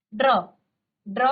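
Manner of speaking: ड्र with half ड and full र, repeated; drilled dra. Shown as a demonstration. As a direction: isolate ड्र twice, ड half. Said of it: "ड आधा और र का पूरा उच्चारण".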